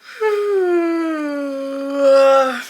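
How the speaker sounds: long loud groaning sigh of exhaustion; groaning sigh, migraine and worn out; exhausted groan. Real, not acted. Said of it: "I'm struggling".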